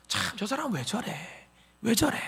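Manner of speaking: quiet, baffled tut-tutting; disapproving aside. Acted. Onlooker judging an angry person, disapproving aside. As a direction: say it under your breath, judging. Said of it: "그 사람을 어떻게 평가합니까".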